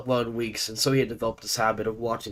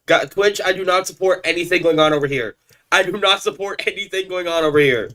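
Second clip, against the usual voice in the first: loud, raised-pitch public disclaimer; emphatic disclaimer; 7 semitones higher, 7 dB louder than usual. Real, not acted. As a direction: declare it loudly, as if to the moderators. Loud disclaimer addressed to Twitch.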